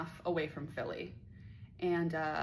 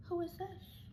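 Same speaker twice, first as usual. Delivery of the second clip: whispered, tense and high; nervous whisper; 12 semitones higher, 8 dB quieter, slower than usual. Retold or imitated. Recognizing the caller, she whispers nervously. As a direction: breathe it out, suddenly nervous. Scared, butthole clenched.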